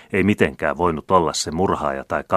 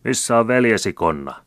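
higher, louder accusing cry; angry shout; 6 semitones higher, 5 dB louder than usual. A narrator's reading. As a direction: hurl the question at him. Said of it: "huusivat muutamat".

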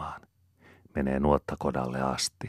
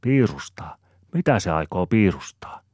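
higher, louder puzzled "piirustaa"; puzzled wondering; 4 semitones higher, 6 dB louder than usual. A narrator's reading. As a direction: ask in mild wonder, "piirustaa" without the burr. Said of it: "Aapeli ihmettelee". (ihmetellen kysyen)

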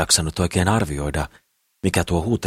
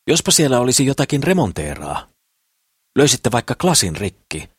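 lively, higher-pitched schemer; scheming Kukkelman; 4 semitones higher, 3 dB louder than usual. A narrator's reading. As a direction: pitch a bright idea eagerly. (innokkaasti juonitellen, korkeammalla äänellä)

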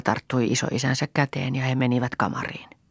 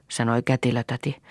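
plain reading voice

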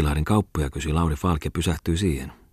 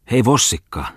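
higher-pitched hail; calling out; 5 semitones higher than usual. Acted. Jahvetti calls for a cab, hailing the driver. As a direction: hail the cab across the street.

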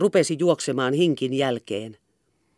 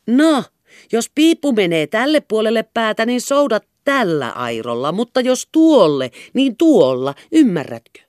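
impatient instructions; 7 semitones higher, 6 dB louder than usual. A narrator's reading. Hinkki instructing nervous, impatient.

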